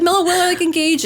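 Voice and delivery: high-pitched, overcome retelling; retold tearful excitement. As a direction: re-enact blurting big news while close to tears. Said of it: "I was, like, crying".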